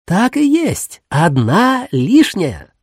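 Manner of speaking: high, drawn-out happy grandma; pleased old woman, slowly; delighted. A narrator's reading. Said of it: "обрадованно сообщила бабушка".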